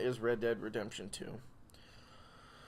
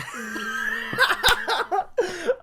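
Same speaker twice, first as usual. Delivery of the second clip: high burst of laughter; 9 dB louder than usual. Real, not acted. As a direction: crack up mid-line. Breaks up mid-line.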